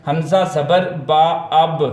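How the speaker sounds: slow, measured spelling aloud; name حمزہ زبر با slowly, then land on اب; spelling-out demo. Shown as a demonstration. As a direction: show a child how to build اب, piece by piece. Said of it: "کیسے پڑھنا ہے".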